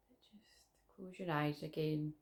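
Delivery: slow unvoiced whisper; right in your ear. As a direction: whisper it softly, slowly.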